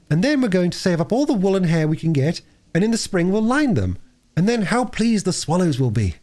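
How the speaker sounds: an excited little sister bubbling over; little girl Phyllis. Acted. Young girl telling her brother and sister the nest plan, eager.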